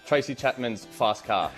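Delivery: usual speaking voice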